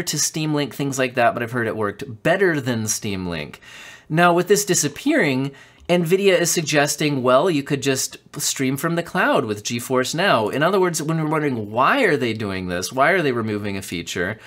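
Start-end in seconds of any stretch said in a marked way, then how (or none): none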